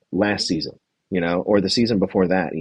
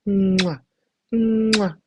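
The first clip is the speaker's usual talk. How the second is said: mock kisses; 12 semitones higher than usual. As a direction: blow exaggerated kisses. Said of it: "He's like".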